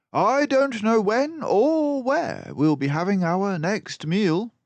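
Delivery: high, loud, brisk detective voice; a clever mouse sleuth, pleased with himself; Basil the mouse detective. A narrator's reading. Narrator voicing Basil to Dawson, high and loud.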